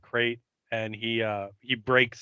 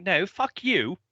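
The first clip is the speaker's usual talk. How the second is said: higher, mock-tough comeback; Giles retort; 8 semitones higher than usual. Giles staking the parrot, a higher mock comeback. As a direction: throw the insult back in Giles' voice. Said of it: "and then Giles is like".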